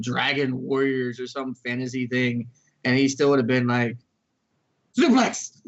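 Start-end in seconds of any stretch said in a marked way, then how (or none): 5.0-5.6 loud, high shouted Suplex; hammed-up wrestling shout; mock battle cry. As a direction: yell it like a dumb hero's move call. Friend mimics the hero's battle cry.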